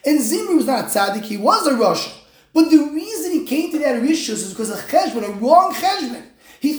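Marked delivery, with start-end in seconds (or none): none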